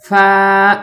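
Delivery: clearly held فا, f made on the teeth; lip-to-teeth f. Shown as a demonstration. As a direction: set lip on teeth, then release a level فا. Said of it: "نچلے ہونٹوں کو اوپر والے دانتوں".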